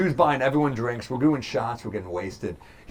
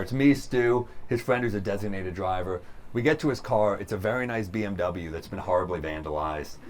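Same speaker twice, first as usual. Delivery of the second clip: normal talking voice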